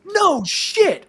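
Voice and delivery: high, loud mock astonishment; amazed reaction. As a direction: blurt it out like a thrilled friend. Acting out Ryan Reynolds' amazed reaction.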